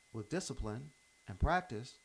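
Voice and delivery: normal talking voice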